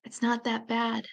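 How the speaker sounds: barely enough air for the words; dying father wheezing softly. Retold or imitated. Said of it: "he wheezes".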